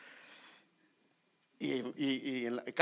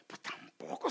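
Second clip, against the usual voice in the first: unvoiced whisper, a private retort; whispered thought. Preacher retells his doubt during the sermon, whispered. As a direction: murmur it under your breath. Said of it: "solo me pasó en la mente".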